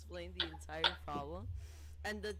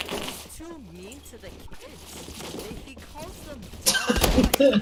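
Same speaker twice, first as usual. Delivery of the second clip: higher, louder prim complaint; mock staff complaint; 6 semitones higher, 17 dB louder, slower than usual. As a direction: school staff reporting to mother, prim and disapproving. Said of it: "but they were like".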